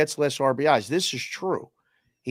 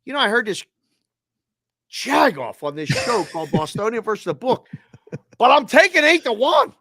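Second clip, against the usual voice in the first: smug bettor voice, pitched higher; mock bad-guy bettor; 8 semitones higher, 6 dB louder than usual. Caricatured bettor placing a long-shot bet, smug and high. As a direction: play the sharp bettor rubbing it in. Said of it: "bad guy".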